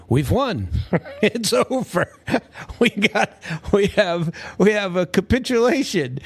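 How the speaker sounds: high, laughing send-up of a boastful president; mocking Trump. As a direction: puff it up like a bragging leader, laughing through it. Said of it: "Trump will come to us and say".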